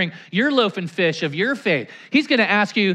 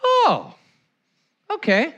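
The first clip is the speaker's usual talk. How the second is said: surprised realization; 8 semitones higher, slower than usual. Retold or imitated. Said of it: "He's like".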